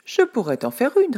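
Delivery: lightly voiced old woman answering; old woman's reply. A narrator's reading. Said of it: "répond la vieille".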